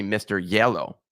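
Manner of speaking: "Yellow" for Jell-O, with a Spanish Y sound; Spanish-accented "Yellow", opening on Y instead of J. Shown as a demonstration. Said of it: "say it with a Spanish accent".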